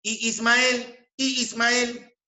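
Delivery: high, held "Y Ismael" as a question; questioning tune. Shown as a demonstration. Teacher showing y meaning "where is", high questioning tune. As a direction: ask "Y Ismael" with the voice kept high. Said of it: "interrogativas cuando equivale a ¿dónde está?".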